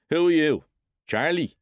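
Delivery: domineering father calling into darkness; father's loud demand. Acted. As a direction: demand to know which son it is. Father demands who is there.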